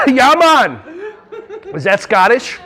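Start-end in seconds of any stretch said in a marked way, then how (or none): 0.1-0.8 mock Jamaican accent. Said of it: "I feel like some Red Stripe".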